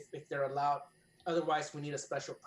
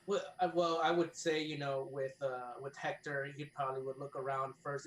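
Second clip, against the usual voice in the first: ordinary talk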